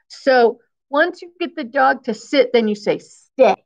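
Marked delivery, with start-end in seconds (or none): none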